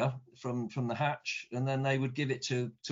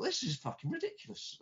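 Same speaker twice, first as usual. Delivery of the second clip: much higher but softer, incredulous; exasperated thought; 12 semitones higher, 7 dB quieter than usual. Retold or imitated. Tourist thinking about split drinking laws, incredulous and soft. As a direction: scoff at the silly rule under your breath.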